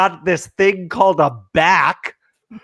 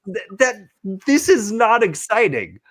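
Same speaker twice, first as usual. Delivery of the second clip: usual speaking voice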